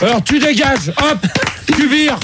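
high-pitched, indignant order to leave; angry dismissal. Real, not acted. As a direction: throw them out angrily. Host throws the screener out.

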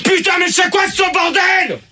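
high-pitched exasperated shout; furious yelling. Real, not acted. Host exploding at the chaos in the studio, furious yelling. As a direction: bellow at the chaos, really furious. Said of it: "Putain, gueule pas".

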